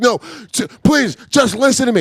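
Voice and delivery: high, pleading exasperation; acting out a frustrated caller; retold anger. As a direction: beg to be heard, fed up. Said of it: "I'm so mad".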